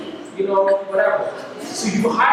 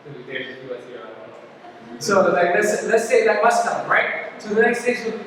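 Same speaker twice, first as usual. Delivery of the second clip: ordinary talk, nothing marked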